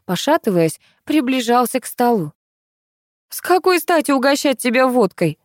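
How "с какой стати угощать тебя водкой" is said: higher-pitched indignant retort. A narrator's reading. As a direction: throw it back at him, affronted. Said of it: "возмутилась я". (возмущённо)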